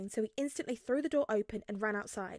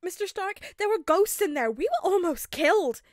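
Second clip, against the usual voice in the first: high, loud frantic cry; exclaimed; 9 semitones higher, 10 dB louder than usual. A narrator's reading. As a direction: plead for sympathy. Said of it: "he exclaimed".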